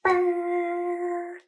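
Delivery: high honking dog noise, mimicked; dog honk imitation. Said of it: "in the quiet of the park, we just hear".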